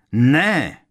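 high, loud cry; sudden screeched protest; shrieked refusal. A narrator's reading. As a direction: shriek to stop someone. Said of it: "vřískl Malfoy".

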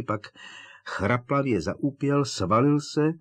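usual speaking voice

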